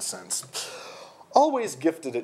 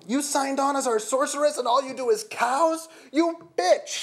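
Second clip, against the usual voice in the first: very high, outraged yelling; angry yell; 14 semitones higher, 3 dB louder than usual. A narrator's reading. Jeff accusing the sorceress of only doing cows, yelling. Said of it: "he yelled".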